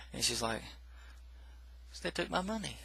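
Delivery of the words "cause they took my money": high, soft, twitchy voice of a dying addict; higher and softer, all twitchy; twitchy junkie. Retold or imitated. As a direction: whimper it out, strung out and hurt. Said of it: "She's, she's all twitchy and stuff".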